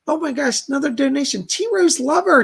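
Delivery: surprised delight, pitch jumping high; light up at the donor's name; excited thanks. Real, not acted. Host thrilled to see a viewer's donation, excited.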